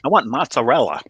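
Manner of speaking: "mozzarella" as mots-a-RELL-a; proper pronunciation. Acted. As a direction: say "mozzarella" with a clear R and final A. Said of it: "properly, they're gonna look".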